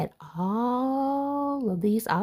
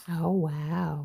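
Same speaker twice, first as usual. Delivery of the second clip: ordinary delivery, nothing marked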